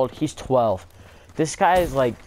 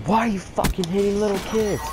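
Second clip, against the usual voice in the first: mock angry stoner; 8 semitones higher than usual. Stoner confronting the boy who hit a kid, mock angry. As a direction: imitate an indignant yell, pitched way up. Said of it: "mad now".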